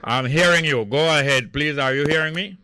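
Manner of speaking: shouting into the phone to a faint caller; calling down the line. Real, not acted. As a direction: raise your voice so the caller can hear.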